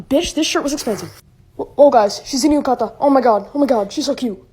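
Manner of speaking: shouting at the screen; loud excited reacting. Real, not acted. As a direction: yell it out, thrilled. Reacting loudly to anime.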